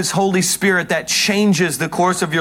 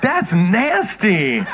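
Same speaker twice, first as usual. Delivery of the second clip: mock disgust; 2 semitones higher, much slower than usual. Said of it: "And I was like".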